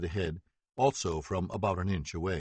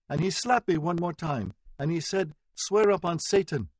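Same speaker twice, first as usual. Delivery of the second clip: high, tearful, louder recounting; distressed telling; 8 semitones higher, 3 dB louder than usual. A narrator's reading. Victim on the stand retelling the slap, distressed. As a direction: shaken as she recalls it.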